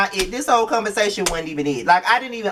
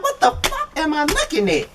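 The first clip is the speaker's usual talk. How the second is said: much higher voice, incredulous; exasperated disbelief. Retold or imitated. Vlogger reacting to the show in high, incredulous disbelief. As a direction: squeal it out in disbelief. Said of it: "I'm like".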